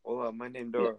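high, light Spanish-accented Dora voice. Shown as a demonstration. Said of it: "just say that with an accent".

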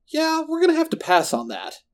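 high-pitched, sarcastic comeback; snappy retort. A narrator's reading. Marco refusing the villain's demand, shot back high. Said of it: "Marco shot back".

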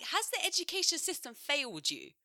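high, exasperated disbelief; mock-baffled question, pitched high; incredulous mock question. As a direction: ask it like they can't be serious. Mocking Americans on TikTok.

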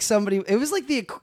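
ordinary talk

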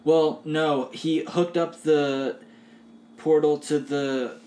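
a struggling character voice; fumbling an explanation in character; character voice slipping. Acted. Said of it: "completely breaking out of the voice".

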